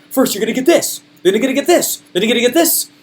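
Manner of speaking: high, rising sing-song list; sales stack pitch. Shown as a demonstration. Seller piling up offers for the audience, high-pitched pitch.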